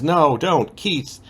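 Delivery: a hint of coy refusal; mock protest. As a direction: pretend to resist while giving in. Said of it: "And Courtney says".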